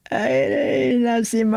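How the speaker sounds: broken tearful cries; sobbing. Acted. Wife telling husband son's in hospital, sobbing. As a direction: sob it out.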